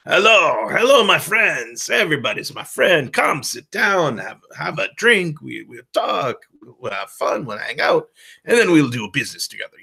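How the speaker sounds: over-the-top rogue greeting, high swoops on the hellos; terrible Hondo impression. Shown as a demonstration. Shady merchant welcoming everybody to drink and do business, over-the-top. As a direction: play a flamboyant, accented rogue welcoming friends. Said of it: "that's a terrible, terrible impression of this character".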